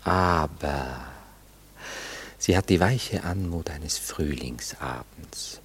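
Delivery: soft, slow, hushed praise; tender reverie. Acted. Man tenderly describing his sweet girl to the lady. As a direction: speak of her as if dreaming aloud.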